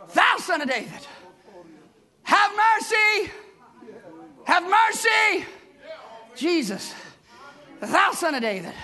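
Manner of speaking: rising, slowing plea for mercy; desperate plea. Acted. Blind man begging Jesus for mercy, pleading cry.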